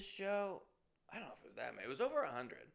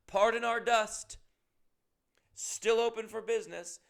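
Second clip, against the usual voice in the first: mock cheerful notice; 8 semitones higher, 8 dB louder than usual. Mocks the sign's cheery tone.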